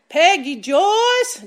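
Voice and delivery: calling out. Retold or imitated. Church elder calling out to the preacher mid-recording, loud. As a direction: call across the room to get her attention. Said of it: "he just calls out".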